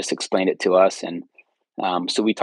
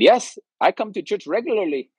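high, bright imitation of an Indian accent; playful Indian accent, pitched up; 11 semitones higher, 4 dB louder than usual. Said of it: "in his Indian accent said".

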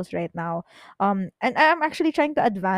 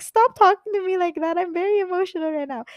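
high, louder tearful protest, mimicked; imitated crying; 10 semitones higher, 6 dB louder than usual. Friend answers a scolding while upset, high mock crying. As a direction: mimic an overwrought friend wailing back. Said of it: "Chloe was, like, crying".

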